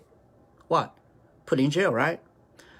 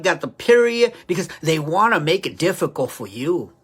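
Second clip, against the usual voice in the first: ordinary talk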